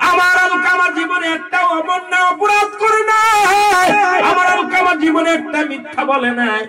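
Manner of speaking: impassioned outcry. Acted. Mother defending Alkama to the Prophet, high impassioned cry.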